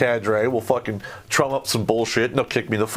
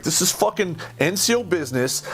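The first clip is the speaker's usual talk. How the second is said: angry yell; 6 semitones higher than usual. Retold or imitated. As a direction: bark it at the officers, now heated. Repeats line to officers, angrier.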